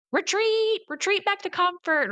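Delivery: high-pitched mock warning cry; inner alarm voice. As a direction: call the retreat in a put-on high voice. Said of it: "Everything within you is gonna say".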